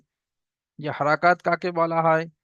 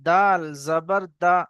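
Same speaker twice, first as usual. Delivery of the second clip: slow, clear call-out of each letter; recitation drill; slower than usual. Acted. Teacher leads students in a slow letter drill. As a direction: chant the letters for the class to repeat.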